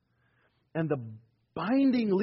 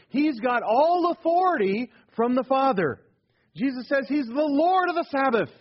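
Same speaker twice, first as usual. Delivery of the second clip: higher, louder preacher's declaration; rising preaching; 7 semitones higher, 5 dB louder than usual. Acted. Preacher proclaiming Jesus's authority to the congregation, rising. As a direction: proclaim it to the hall.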